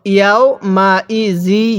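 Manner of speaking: very slow, drawn-out syllables; model recitation. Shown as a demonstration. Teacher models Quran words for learners, slow measured recitation. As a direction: stretch each long vowel evenly. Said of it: "কিভাবে পড়ব".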